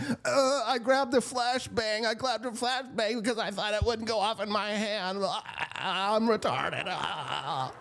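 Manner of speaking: soft, high mocking simpleton voice; mocking dim voice. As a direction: play her as a clueless fool, mockingly. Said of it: "and he's like".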